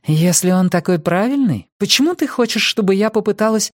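high-pitched, huffy challenge; pouting. A narrator's reading. Nina challenging Kaz about the plan, pouting. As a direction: ask it through a pout, pitch climbing. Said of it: "Нина надулась".

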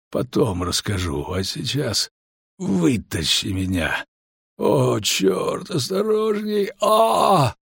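pained, slow, breaking into a high cry; gasping as he is moved, hurting; wounded soldier. A narrator's reading. Wounded man being moved. (раненый, срывается на крик)

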